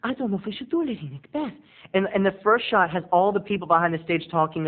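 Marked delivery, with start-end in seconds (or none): 0.0-1.5 worried actress. Retold or imitated. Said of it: "they're doing".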